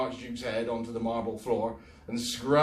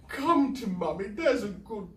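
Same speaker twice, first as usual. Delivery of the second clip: put-on honeyed baby talk; 10 semitones higher, 3 dB louder than usual. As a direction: sweet-talk the cat down. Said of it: "voice was thick and honeyed".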